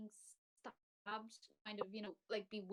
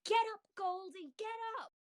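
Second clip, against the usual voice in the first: high, loud shout to wake someone; desperate shout; 12 semitones higher, 6 dB louder than usual. Acted. Player's character shouts to wake Goldie, shouting out. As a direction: take a breath and shout it out. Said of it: "just shout out".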